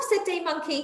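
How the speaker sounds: lively storybook reading voice; reading to children. A narrator's reading. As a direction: read it out like a picture book for little ones. Reading a picture book aloud to children.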